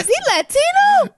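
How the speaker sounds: very high, squealed question; high-pitched amused astonishment; mock incredulous. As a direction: cry it out in comic disbelief. Joking incredulous question amid laughter.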